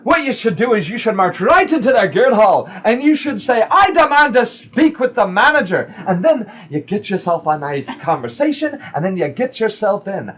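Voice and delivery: high, insistent woman character; bossy adviser. Acted. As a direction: push them to march in and demand. Game master voices advising woman.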